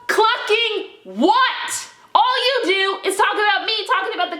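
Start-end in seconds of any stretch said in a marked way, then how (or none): 0.1-1.9 very high and drawn out, loud; slow, high, loud incredulous sneer; sarcastic retort. Real, not acted. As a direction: stretch it out in mock disbelief.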